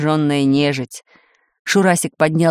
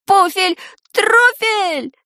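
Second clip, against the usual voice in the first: high-pitched frantic yelp; panicked yell; 12 semitones higher, much slower than usual. A narrator's reading. Stunned boy tries to put out the spark, yelling. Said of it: "завопил ошеломлённый Шурасик".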